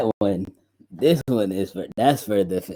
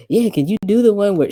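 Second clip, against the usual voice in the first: much higher and louder, a lightly voiced eager client; imitating the client; 13 semitones higher, 8 dB louder than usual. Producer mimicking a client asking for his tag, higher. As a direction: put on the client's eager ask. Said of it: "She's just like".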